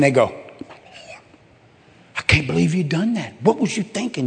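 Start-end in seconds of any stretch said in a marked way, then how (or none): 2.2-4.3 lightly voiced scandalized neighbor, rising high at the end; a hint of a gossip clutching her pearls; shocked neighbor. Neighbor reacting to a confession.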